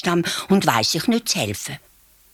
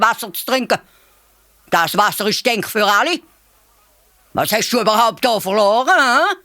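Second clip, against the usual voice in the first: angry mother elephant; 5 semitones higher, 4 dB louder than usual. A narrator's reading. Mother elephant scolds the hare loudly. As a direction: tell him off like an outraged mother.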